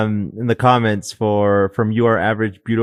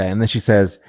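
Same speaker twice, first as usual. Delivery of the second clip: normal talking voice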